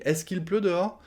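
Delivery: "est-ce qu'il pleut dehors?" high and questioning; question tune. Shown as a demonstration. Asking the partner politely, high questioning tune.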